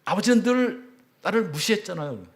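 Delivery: high, loud accusing cry; anguished shout. Retold or imitated. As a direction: yell out years of hurt. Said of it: "외마디 비명처럼 소리를 지르더라고요".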